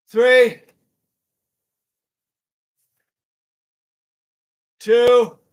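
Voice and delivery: high-pitched, spaced countdown; calling out counts. Real, not acted. Instructor counts the class down through a hold, calling out. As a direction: call each number, building in volume.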